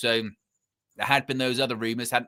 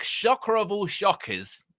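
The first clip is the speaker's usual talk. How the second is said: dry, mock-amazed sarcasm; sarcastic; 8 semitones higher than usual. Real, not acted. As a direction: say it with heavy irony. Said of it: "I'm being sarcastic here".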